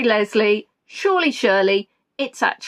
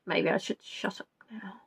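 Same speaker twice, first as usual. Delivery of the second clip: whisper; 4 semitones lower, 13 dB quieter than usual. Real, not acted. Embarrassed aside after bad joke.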